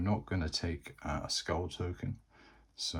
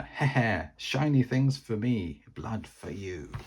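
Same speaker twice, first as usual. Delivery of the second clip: goblin cackle; 4 semitones higher, 5 dB louder than usual. Acted.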